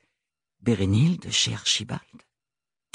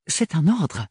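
retort; 6 semitones higher than usual. Acted. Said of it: "rétorqua Archibald".

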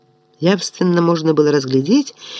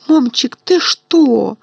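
bewildered fear; 6 semitones higher, slower than usual. Acted. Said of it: "вцепилась в него ничего не понимающая Мими".

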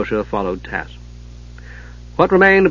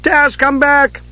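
call; 13 semitones higher, much slower than usual. A narrator's reading. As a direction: call out to the kender. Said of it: "Palin risked a low call".

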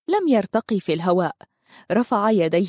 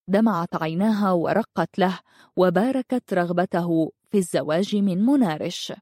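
usual speaking voice

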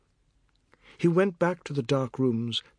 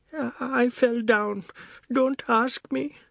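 put-on hurt boy; 11 semitones higher, slower than usual. Hurt boy evading questions.

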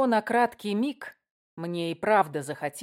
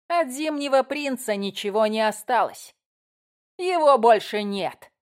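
red cap voice; 5 dB louder than usual. Acted. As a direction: taunt like a leering goblin. Red cap leader taunting.

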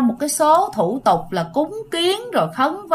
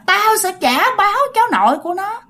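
growling threat; 8 semitones higher than usual. Retold or imitated. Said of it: "còn gầm gừ nói rằng".

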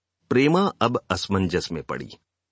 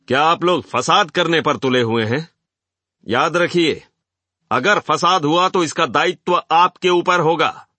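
higher, raised public rebuke; forceful loud warning; 6 semitones higher, 5 dB louder than usual. A narrator's reading. Speaker to a rowdy hall, loud and forceful. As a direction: project a stern warning over the noise. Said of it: "अमृत राय ने जोर से कहा".

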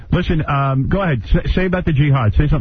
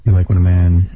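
seductive voice; 7 semitones lower than usual. Acted. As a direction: croon it like a lounge lover. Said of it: "give me a sexy voice".

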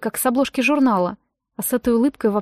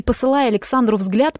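usual speaking voice

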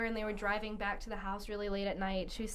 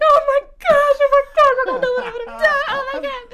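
high, loud, frantic freak-out; flipping out; 15 dB louder than usual. Retold or imitated. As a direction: shriek it like a panicking tenth grader, laughter creeping in. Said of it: "I flipped out".

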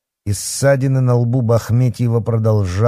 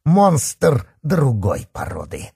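slow, raised, emphatic snarl; roared; 6 semitones higher, slower than usual. A narrator's reading. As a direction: roar it out word by word. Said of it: "рявкнул он".

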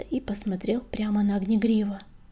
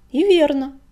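plain reading voice

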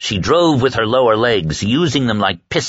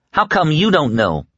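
higher, pleading cry; cried out; 7 semitones higher than usual. A narrator's reading. Woman crying out at her captor, pleading. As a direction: throw the question at him. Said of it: "she cried at him".

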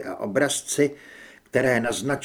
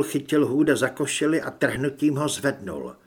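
ordinary delivery, nothing marked

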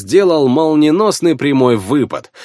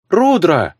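higher, startled cry of a name; surprised; 4 semitones higher than usual. A narrator's reading. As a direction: call out in surprise. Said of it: "удивлённо проговорила Анакана".

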